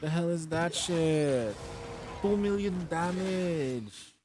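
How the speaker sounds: slow, drawn-out amazement; stunned disbelief. Real, not acted. Gamer staring at two million damage, stunned. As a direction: stretch every word out in disbelief.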